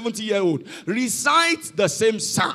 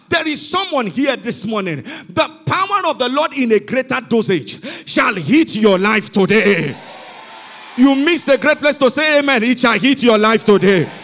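loud declaration building to a ringing finish; full-force preaching; 5 dB louder than usual. Real, not acted. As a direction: proclaim it over the congregation. Preacher declares over congregation.

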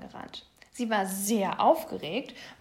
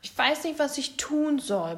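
mild storybook grumble, pitched up; grumbling; 5 semitones higher, 5 dB louder than usual. A narrator's reading. Bored little bear with nothing to do, grumbling. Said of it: "brummte er".

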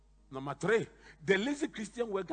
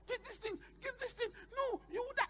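much higher, light mock wife voice; imitating mommy; 4 dB quieter than usual. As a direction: play the worried wife taking the laptop. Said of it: "mommy will walk to my room and say".

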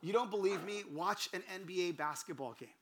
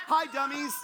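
high, loud, oblivious hello; cheery shouted greeting; 8 semitones higher, 7 dB louder than usual. Retold or imitated. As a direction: call it out brightly to a whole room. Replays greeting to the congregation.

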